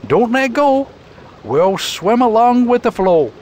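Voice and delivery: explain, then give firm orders; Burton. Acted. Burton explaining the flood to Lindsay, steady and firm. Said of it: "Burton answered".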